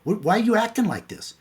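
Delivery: high-pitched, baffled wife voice; puzzled wife. Retold or imitated. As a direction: imitate a wife demanding an explanation. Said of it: "She goes".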